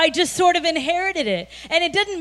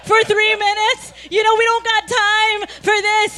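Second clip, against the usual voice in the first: higher, louder exasperated complaint; exasperated rant; 6 semitones higher, 4 dB louder than usual. Retold or imitated. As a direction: rant at him, fed up and half-laughing. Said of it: "And I'm like, ... so mad".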